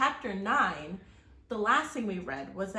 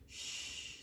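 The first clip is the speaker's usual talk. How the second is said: soft unvoiced shushing; whispered shush; 11 dB quieter than usual. Jack hushing Annie inside the quiet house, whispered shush. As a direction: hush her so parents won't hear. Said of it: "said Jack".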